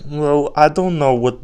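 put-on high, pleading childlike voice; confused little-kid brain. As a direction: whine helplessly like a cornered kid. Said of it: "And our brains are like".

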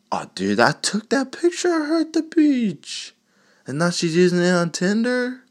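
shocked friend. Acted. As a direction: shriek it like a betrayed ex. Acted dismay on call.

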